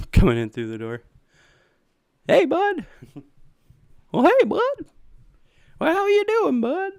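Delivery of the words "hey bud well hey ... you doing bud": very high, cartoonish friendly voice; squeaky-nice visitor checking in; imitating Foxy. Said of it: "why does Foxy have that voice".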